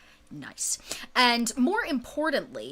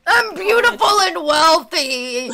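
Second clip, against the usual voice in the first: loud, high, slow, laughing; laughing mock; 7 semitones higher, 9 dB louder, slower than usual. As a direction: echo him with a giggle. Player mocks NPC while laughing.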